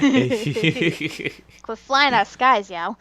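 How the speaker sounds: put-on Jersey accent. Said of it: "It's not even a Jersey accent".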